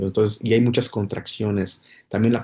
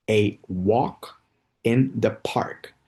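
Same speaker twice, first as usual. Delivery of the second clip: slow idiom demo; much slower than usual. Shown as a demonstration. Teacher slowly models the idiom "a walk in the park" for learners. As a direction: lay out "a walk in the park" gently, word by word. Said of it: "otro idioma muy común es decir".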